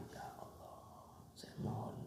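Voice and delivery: a whispered, private prayer; soft whisper. Shown as a demonstration. Preacher demonstrating a quiet prayer to his congregation, whispered. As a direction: breathe the plea to God without voice. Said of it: "permintaan yang pelan".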